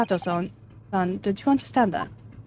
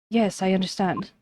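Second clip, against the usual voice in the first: normal talking voice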